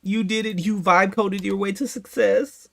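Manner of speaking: loud, high sarcastic cheering with laughs; sarcastic hammed-up praise, loud and high; mock celebration. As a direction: overblown fake joy for the vibe coder. Mock celebration of vibe coder.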